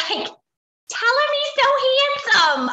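high, loud, drawn-out gushing; imitating a doting mom's proud call; gushing mother. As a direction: stretch it out high in exaggerated adoration. Voicing his mother's gushing message.

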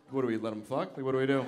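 low, hesitant aside to a colleague; mildly puzzled bystander; unsure counselor. Acted. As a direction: ask it low, unsure what to do. One counselor quietly asks the other.

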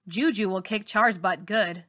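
plain reading voice

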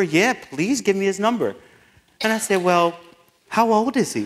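high, loud and eager; eager excitement. Retold or imitated. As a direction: rush in with excitement. Eager retold phone call.

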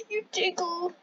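shrieking ticklish laugh; being tickled. Real, not acted.

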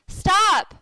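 loud, high shout; yelled command. A narrator's reading. Boy halting Michael's poke at the sea lion, yelled command. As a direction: shout it to halt him at once. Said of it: "I yelled".

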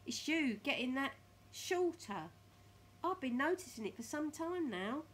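Mr Twit gloating. Acted. Mr Twit telling his wife she is shorter, loud gloating. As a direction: rub it in loudly.

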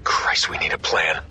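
hushed, tense whisper. Acted. Soldier sneaking past enemies to his team, tense whisper. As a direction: keep it under your breath so they don't hear.

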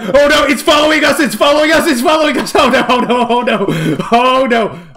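high, loud repeated cries; frantic wail, easing slightly; panicked alarm. Real, not acted. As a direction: cry out that you are being chased. Being followed by monster.

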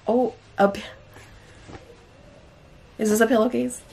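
ordinary talk

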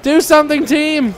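high-pitched plea to teammates; panicked calling to the team; urgent pleading. Real, not acted. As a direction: beg the team to defend, voice up. Urging team to defend.

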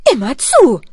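loud eager mother; loud encouragement. Acted. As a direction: urge them on loudly. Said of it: "sagt die Mutter".

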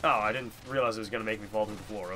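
normal talking voice

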